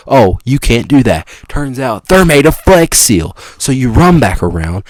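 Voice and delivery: usual speaking voice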